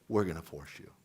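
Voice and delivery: the government's real message, dropped low and dry; wry reveal. Retold or imitated. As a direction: let the catch land dryly. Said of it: "Oh, but by two thousand sixteen".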